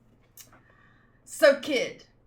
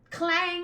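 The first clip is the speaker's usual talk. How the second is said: bell clang; 8 semitones higher than usual. Acted. Voicing the golden bell for the listeners, a ringing clang. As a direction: belt out the bell's clang.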